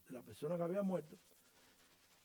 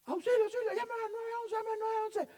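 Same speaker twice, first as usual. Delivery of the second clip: high, loud mock panic shout; panicked calls for help; 7 dB louder than usual. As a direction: cry out for help as if someone is drowning. Crowd calling for help.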